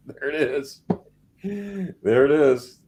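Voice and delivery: slow, drawn-out amused approval; laughing delight. Real, not acted. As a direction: savor it slowly through laughter. Delighted at guest's accent.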